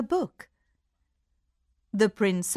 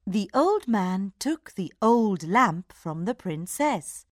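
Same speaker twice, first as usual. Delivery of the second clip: ordinary delivery, nothing marked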